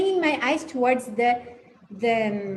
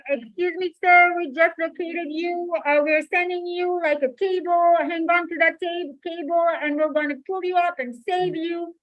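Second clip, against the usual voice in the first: helicopter rescuer voice; 5 semitones higher than usual. Acted. Helicopter rescuer calls to man.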